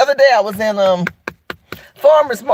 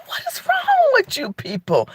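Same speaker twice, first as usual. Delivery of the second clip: high, softer exasperated mutter; exasperated aside; 9 semitones higher, 5 dB quieter than usual. Retold or imitated. Talking to herself about rude people, exasperated. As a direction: shake your head at the world. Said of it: "I say to myself".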